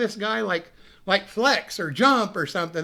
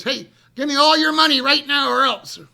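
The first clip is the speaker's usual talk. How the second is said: robber's demand; 6 semitones higher, 8 dB louder than usual. Retold or imitated. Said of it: "Uh, says".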